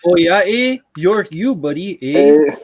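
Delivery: high, loud hammed-up Canadian accent, eh. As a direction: play a jokey Canadian buddy. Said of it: "that's a really good Canadian accent".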